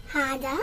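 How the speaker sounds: "Hada" said carefully as HA-da; pronunciation demo. Shown as a demonstration. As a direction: model "Hada" for learners, two clear syllables. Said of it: "teach you how to pronounce it".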